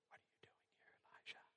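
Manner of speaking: barely audible divine whisper; hushed, voiceless whisper; gentle whisper. A narrator's reading. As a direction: whisper the question very softly. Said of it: "the sound of a gentle whisper".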